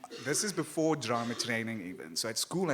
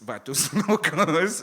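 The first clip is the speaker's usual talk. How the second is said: louder, slow, laughing through "Vatos Locos"; laughing drill; 5 semitones higher, 11 dB louder, slower than usual. Retold or imitated. Father drills the phrase on his son, laughing. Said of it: "he would make me say that phrase".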